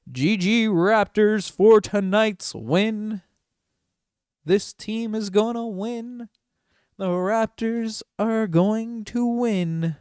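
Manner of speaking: high, slow, showy announcer call; hype announcer voice. Acted. Fan streamer hyping his team's win, announcer voice. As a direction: boom it out like an arena announcer.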